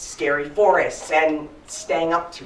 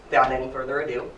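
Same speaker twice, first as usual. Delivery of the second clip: usual speaking voice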